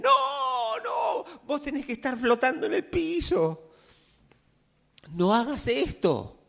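comic mock disciples whining high; panicked disciples. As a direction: beg Jesus not to be human, hammed-up. Disciples insist he should float.

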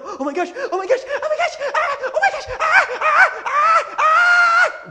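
high, loud mock panic squeals; shrieking "oh my gosh" in play-acted terror. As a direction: ham up a terrified patient before the needle. Acting panic before a shot.

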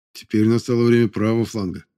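plain reading voice

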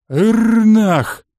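loud booming war cry; animal roar. A narrator's reading. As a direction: roar it like a beast. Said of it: "раскатистым басом".

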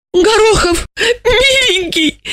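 very high, tearful wail; sobbing lament. A narrator's reading. Asya pleads with doomed Gorokhov, sobbing and wailing. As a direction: lament through sobs. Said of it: "всхлипывая, запричитала она".

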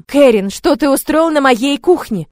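loud, slow scolding outrage; indignant. Acted. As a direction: tell him off, outraged. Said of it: "возмутилась я".

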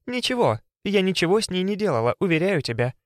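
feigned innocence. A narrator's reading.

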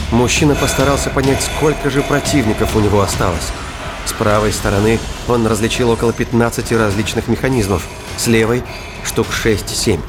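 ordinary talk

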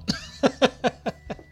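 a high, airy, unvoiced laugh; breathy laugh. Real, not acted. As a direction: laugh at yourself under the breath. Laughs at his own remark. (petit rire soufflé, sans voix)

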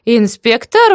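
high, loud rising "Инспектор?"; surprised. A narrator's reading. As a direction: echo it back in astonishment. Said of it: "удивилась тётка".

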